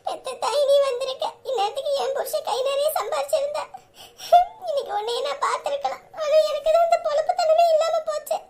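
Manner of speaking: high, choked voice between sobs and laughs; tearful lament. Acted. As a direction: lament to mother, voice breaking. Daughter laments to mother, tearful.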